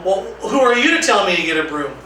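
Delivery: ordinary delivery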